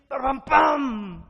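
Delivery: loud, booming vocal fanfare; triumphant mouthed trumpet blast; imitated trumpet fanfare. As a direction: belt out a trumpet call with the voice.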